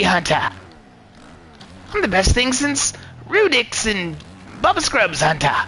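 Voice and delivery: high, butchered Australian-English taunts; hammed-up Australian accent, high with falling name; mock Australian-English accent. Trash-talking rival Hunter from his short, hammed-up high Australian accent. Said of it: "say things in that accent".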